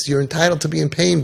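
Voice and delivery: normal talking voice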